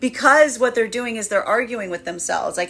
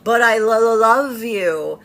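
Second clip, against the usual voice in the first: louder, slow, halting over "love"; stammering masculine voice; 2 semitones higher, 5 dB louder, slower than usual. Retold or imitated. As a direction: stumble shyly through the confession. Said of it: "masculine goes".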